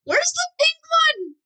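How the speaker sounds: slow, drawn-out high shout; high-pitched demanding yell. Acted. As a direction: demand it loudly, stretching the words out. Acted popsicle quarrel.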